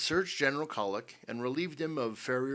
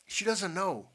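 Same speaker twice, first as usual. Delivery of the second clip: higher-pitched urgent protest; 8 semitones higher, 4 dB louder than usual. A narrator's reading. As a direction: insist on it urgently. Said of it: "said Jupiter Jones urgently".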